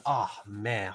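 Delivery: normal talking voice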